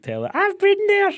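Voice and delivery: very high, loud squeal; tipsy shriek over her accent; squealing excitement. Retold or imitated. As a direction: shriek with delight. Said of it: "So I was like".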